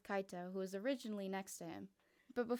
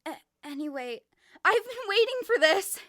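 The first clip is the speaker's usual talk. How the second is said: loud, high and eager; excited yell; 10 semitones higher, 14 dB louder than usual. Acted. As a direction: burst out with glee. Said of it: "she yelled".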